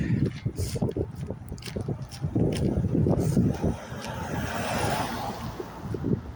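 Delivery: long airy laugh under the breath; breathy laugh. Real, not acted. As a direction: laugh softly and breathily for a while. Laughing at herself on walk.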